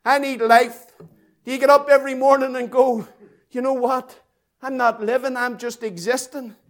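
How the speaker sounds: ordinary talk, nothing marked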